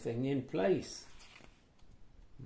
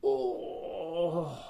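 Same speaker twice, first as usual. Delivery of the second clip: cried out. Acted. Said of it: "cried old Tom".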